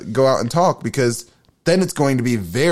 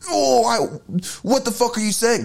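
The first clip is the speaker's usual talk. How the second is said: defensive outburst; 11 semitones higher than usual. Retold or imitated. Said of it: "put my guard up and be like".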